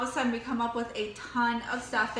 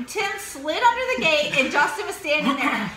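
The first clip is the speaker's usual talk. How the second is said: ordinary talk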